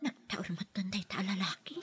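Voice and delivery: breathy whispered aside, unvoiced and soft; whisper to herself. Maid whispering to herself about the handsome man. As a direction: whisper it so the friend barely hears. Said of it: "Anya ti kunam gayem?".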